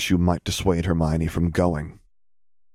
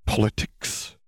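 venomous; 3 semitones higher, slower than usual. Acted. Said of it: "with no small amount of venom".

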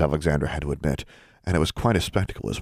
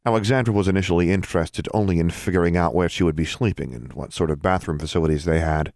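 plain reading voice

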